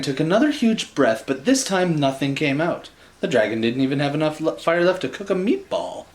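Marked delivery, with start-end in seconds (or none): none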